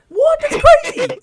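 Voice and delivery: high, loud laughing outburst; laughing disbelief. Real, not acted. Co-host cracking up at his own joke, laughing loud. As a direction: blurt it out through a laugh.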